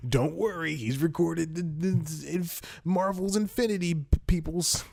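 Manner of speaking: high-pitched, put-on mimicry; mocking quote. Podcaster mocking reporters reassuring fans after a death, mocking voice. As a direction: parrot it high in a silly mock voice.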